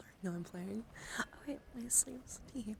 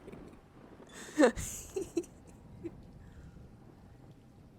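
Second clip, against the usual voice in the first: a much higher, teary laugh she can't hold in; helpless laughter; 8 semitones higher, 3 dB louder than usual. Real, not acted. Still laughing after saying sorry, near-tearful giggles. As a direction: laugh until it almost turns to crying.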